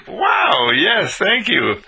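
high, slow, delighted acceptance; pleasant surprise. Acted. Offered a bowl of ice cream unexpectedly, pleasant surprise. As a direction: accept it with an amazed wow. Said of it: "You weren't expecting it".

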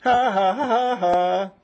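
loud high belly laugh. Real, not acted. Teacher laughing loudly at his students.

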